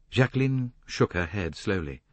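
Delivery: plain reading voice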